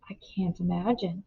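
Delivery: May whispering in alarm at the door; whispered. A narrator's reading. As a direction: breathe it out under the knocking. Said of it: "whispered May".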